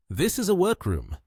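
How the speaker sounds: high-pitched, mildly shy protest. A narrator's reading. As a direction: say it shyly, pitched well up. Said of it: "Kyun Nian shyly said".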